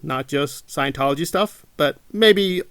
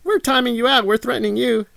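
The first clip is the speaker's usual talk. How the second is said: high, loud mocking laugh, then gloating; 9 semitones higher, 4 dB louder than usual. Mimicking gloating moderators with a loud, high jeering laugh. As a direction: jeer like a smug troll.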